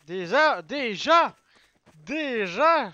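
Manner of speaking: loud repeated call. Real, not acted. Streamer calling out in game, loud. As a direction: shout the word again and again.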